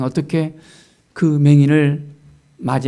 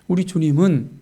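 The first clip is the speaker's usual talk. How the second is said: ordinary talk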